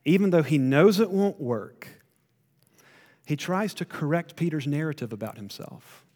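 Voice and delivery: normal talking voice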